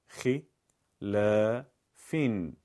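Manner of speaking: ح، س، بن split into separate syllables with pauses. Shown as a demonstration. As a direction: spell out ح، س، بن one syllable at a time. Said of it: "بخشخوانی".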